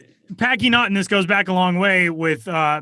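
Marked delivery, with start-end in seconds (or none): 0.4-0.9 "Packy Naughton" higher and louder in a hammed-up Boston accent. Host shows his terrible Boston accent to his cohost. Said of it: "I do just a terrible Boston accent".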